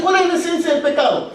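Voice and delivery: normal talking voice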